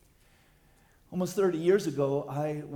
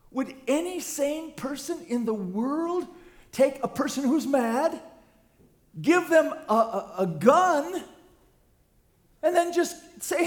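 loud, raised preaching question; preaching at full force; 11 semitones higher, 8 dB louder than usual. Acted. Pastor asking the church about arming the mad, loud preaching. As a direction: press the absurdity on the listeners.

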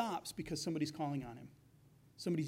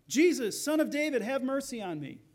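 loud, raised cry for mercy; 6 semitones higher, 6 dB louder than usual. Acted. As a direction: call out high over the crowd, easing at the end. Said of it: "he cries out".

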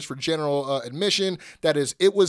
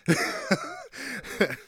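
high-pitched laugh; 4 dB quieter than usual. Real, not acted.

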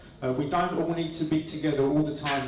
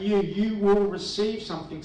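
"You" doubled and pitched up high; stress on You; 5 semitones higher, 4 dB louder than usual. Shown as a demonstration. Preacher tells listeners Jesus is speaking to them, stressing "You". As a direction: hit "You", then repeat it with emphasis. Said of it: "just gonna emphasise each word".

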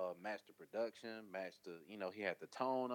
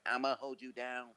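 slow, raised-pitch imitation of a rapper; a hint of an alien voice; imitated alien voice; 5 semitones higher, 3 dB louder than usual. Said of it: "was that the alien voice".

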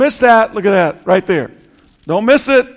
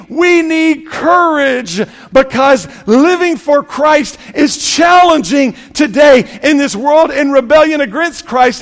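fiery preaching; 4 semitones higher than usual. Acted. Pastor rallying the congregation, fiery full-force preaching. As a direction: preach it out, building the repeated line.

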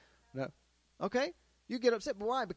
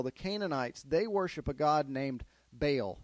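normal talking voice

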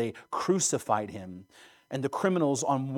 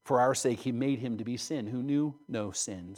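usual speaking voice

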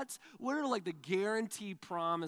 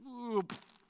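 mock crowd wince; 6 semitones higher than usual. Crowd watching the fall.

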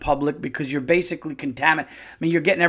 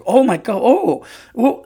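mock fright, rising higher and louder; startled shoppers; 8 semitones higher, 6 dB louder than usual. Imitating shoppers frightened by the sneeze, mock panic. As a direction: squeal it like scared shoppers. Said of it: "they were just like".

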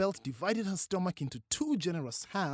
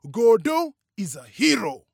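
loud, emphatic declaration; solemn, forceful announcement; serious, proud father; 6 semitones higher, 12 dB louder than usual. A narrator's reading. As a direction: proclaim it in all seriousness, punching the key words. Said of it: "in all seriousness".

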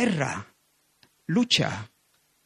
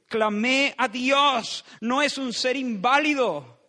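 fervent preaching; 7 semitones higher than usual. Acted. Preacher insisting on whom he cried to, fervent and high. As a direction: preach it with fire, pitched high.

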